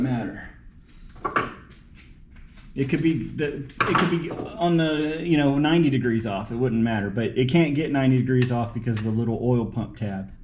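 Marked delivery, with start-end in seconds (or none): none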